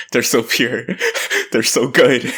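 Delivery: high, giggly adoring gush; gushing laugh. Real, not acted. Co-host adoring the pair of characters, giggly gushing. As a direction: gush through little laughs.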